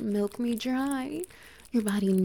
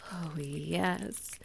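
usual speaking voice

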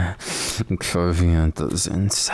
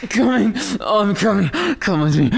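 much higher, loud ecstatic cry; climax cry; 3 dB louder, much faster than usual. Acted. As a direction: cry out at the peak. Climax cries.

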